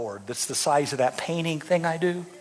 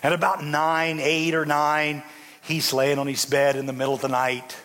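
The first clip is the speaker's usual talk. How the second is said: normal talking voice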